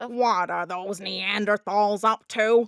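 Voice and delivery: slow, stretched cartoon whine; Squidward voice. Shown as a demonstration. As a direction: drawl it like a grumpy squid. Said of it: "if you prep with a k".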